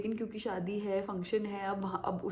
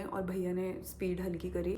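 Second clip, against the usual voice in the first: ordinary talk, nothing marked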